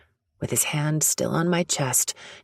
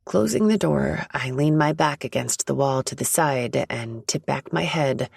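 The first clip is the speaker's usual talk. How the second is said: ordinary talk, nothing marked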